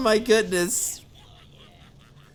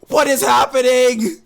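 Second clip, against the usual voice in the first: mock despair; 2 semitones higher, 4 dB louder than usual. Real, not acted. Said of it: "Oh, I'm about to cry".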